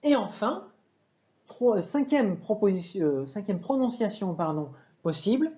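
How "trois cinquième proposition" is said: normal talking voice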